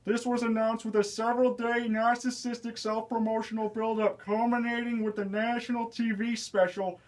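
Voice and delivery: lightly voiced whiny Long Island comic; Ray Romano impression. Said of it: "Ray Romano".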